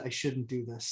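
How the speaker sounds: low, soft aside to oneself; reluctant self-talk. Retold or imitated. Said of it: "I literally said out loud".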